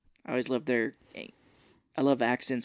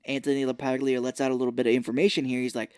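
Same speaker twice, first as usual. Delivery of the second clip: ordinary talk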